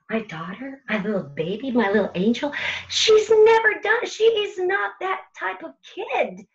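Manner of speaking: building from hushed to high and loud disbelief; a parent's panic rising into a cry of disbelief; panicking parent. Retold or imitated. Parent panics at news of fight.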